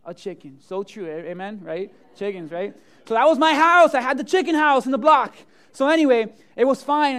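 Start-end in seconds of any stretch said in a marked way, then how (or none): 3.0-7.2 much louder and higher; nearly shouting it out; loud excited telling. Real, not acted. Pastor telling the crowd about the chicken house, loud.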